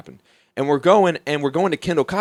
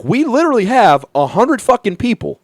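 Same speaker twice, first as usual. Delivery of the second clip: incredulous shout; 8 semitones higher, 5 dB louder than usual. Retold or imitated. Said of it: "And I was like".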